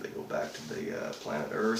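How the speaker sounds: normal talking voice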